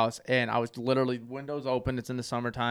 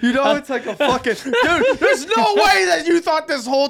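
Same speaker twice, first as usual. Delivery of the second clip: high, loud laughing disbelief; laughing, can't believe it; 9 semitones higher, 5 dB louder than usual. Real, not acted. Mocking friend's duck call belief.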